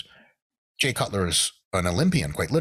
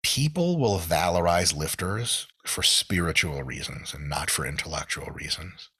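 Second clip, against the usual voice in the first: ordinary delivery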